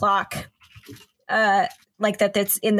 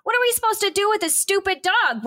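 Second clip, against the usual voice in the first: much higher, indignant protest; indignant outburst; 9 semitones higher than usual. Acted. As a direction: rise up in outrage. Hera upset about leaving.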